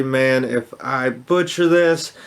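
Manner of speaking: ordinary delivery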